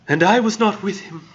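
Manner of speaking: high, lightly voiced grieving woman; mock heartbroken woman's voice; murmured. A narrator's reading. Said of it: "she murmured".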